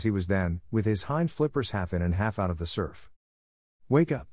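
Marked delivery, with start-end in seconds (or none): 3.9-4.3 high, loud bark at a sleeping walrus; barked wake-up call. A narrator's reading. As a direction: snap him awake with a sharp bark. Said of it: "barked Kotick".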